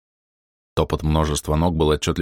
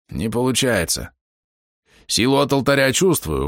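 lightly voiced necromancer, raised in pitch; necromancer Over; 6 semitones higher than usual. A narrator's reading. Necromancer admitting the summoning fails, lightly voiced and higher.

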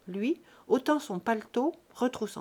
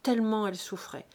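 usual speaking voice

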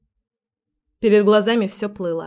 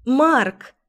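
joyful cry; 4 semitones higher than usual. Acted. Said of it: "радостный крик".

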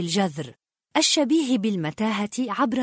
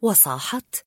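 usual speaking voice

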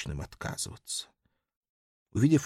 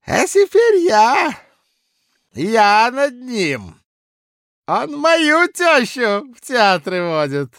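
put-on loud, high drunken bluster; drunken blustering official; 8 semitones higher, 10 dB louder than usual. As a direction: boast it loud like a tipsy clerk. Drunk Kozulin gloats.

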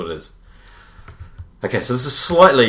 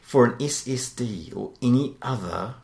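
product blurb reading; 4 semitones higher than usual. Acted. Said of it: "I'll get a few more clues".

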